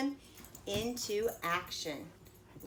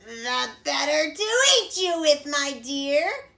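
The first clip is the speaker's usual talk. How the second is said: loud, higher snarling; 6 semitones higher, 9 dB louder than usual. A narrator's reading. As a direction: snarl before the pounce. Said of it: "snarled the wolf".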